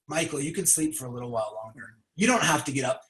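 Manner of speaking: the mind tempting Michael to sleep in, low and soft; tempting inner voice. Pretend. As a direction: coax him back to sleep like a sly whisperer in the ear. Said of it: "that mind to come in there and say".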